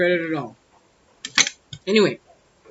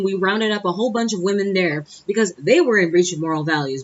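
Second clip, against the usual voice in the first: normal talking voice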